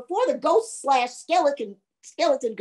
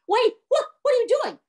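high-pitched panicked protest; alarmed ghost; 12 semitones higher, faster than usual. Acted. Ghost losing its rib cage, high alarmed protest. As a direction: blurt it out high and startled.

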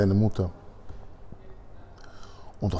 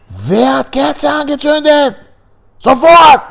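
much higher and louder, slow barked demand; furious shout; 11 dB louder, slower than usual. Acted. Nazi guard to the girls in the barrack, furious shout. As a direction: roar the question at the prisoners.

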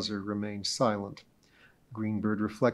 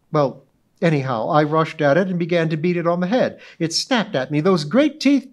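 high, loud, grandly self-important society lady; pompous witness; 6 semitones higher, 7 dB louder than usual. Acted. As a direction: orate as if giving a club speech. Mrs Donahue dramatic account.

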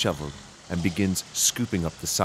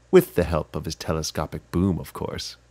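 ordinary delivery, nothing marked

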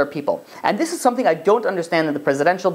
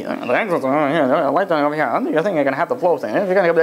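lightly mocked rote safety patter; flight attendant routine; 2 semitones lower, faster than usual. Flight attendant giving the memorised safety talk, mumbled mock recital. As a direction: run words together like a tired announcement. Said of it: "When a flight attendant come up".

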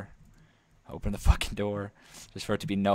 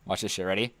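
ordinary talk

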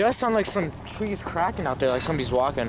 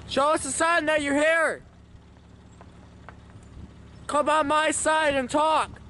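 high raised calling voice; talking to a ghost; 10 semitones higher than usual. Real, not acted. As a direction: coax the spirit to come closer. Addressing spirits at cemetery.

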